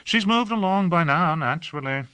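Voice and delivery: Hawke, casually dismissive; light, airy. A narrator's reading. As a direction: toss off "naturally" as obvious. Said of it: "Hawke said lightly".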